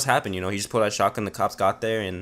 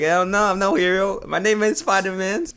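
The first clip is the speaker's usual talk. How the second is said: higher, slow, hammed-up superhero boast; mock hero voice; 8 semitones higher than usual. Refusing to fight the gunman, a hammed-up high superhero voice. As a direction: puff up like a joke superhero, much higher and slow. Joking about taking down gunman.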